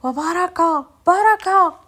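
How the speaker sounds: loud, high shout to stop them. Retold or imitated. Brother-in-law stopping the women beating her, loud shout. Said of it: "هو يبدا يغوت".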